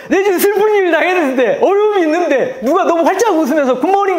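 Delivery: high, loud mock-annoyed rant. Pastor acting his irritation at a chirpy greeting, high mock rant. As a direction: vent like someone fed up with cheer. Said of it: "그거 아시죠?".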